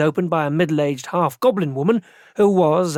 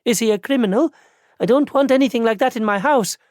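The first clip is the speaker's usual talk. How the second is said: put-on landlady's Barajese accent, higher and worried; wary landlady in a Barajese accent; 8 semitones higher than usual. A narrator's reading. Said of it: "she said in a strong Barajese accent".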